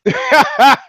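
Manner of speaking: loud, high burst of laughter; real laugh. Real, not acted. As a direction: crack up at your own punchline. Laughs at own story.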